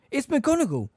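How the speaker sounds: plain reading voice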